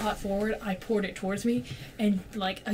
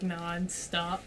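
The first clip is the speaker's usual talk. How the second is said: ordinary delivery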